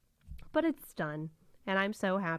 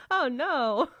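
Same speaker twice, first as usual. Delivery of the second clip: Italian-ish oh no; 5 semitones higher, 6 dB louder than usual. Retold or imitated. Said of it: "this accent ... very Italian".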